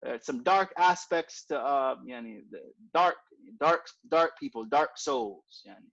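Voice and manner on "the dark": ordinary talk, nothing marked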